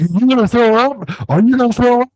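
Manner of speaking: high, mocking singsong; lightly hammed-up taunt; teasing friend. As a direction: tease him like a gleeful buddy.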